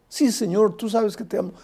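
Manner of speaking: a believer's heartfelt yes, pitched high; earnest pleading answer. Acted. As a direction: answer him with an eager, lifted voice.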